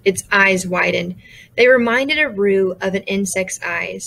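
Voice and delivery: plain reading voice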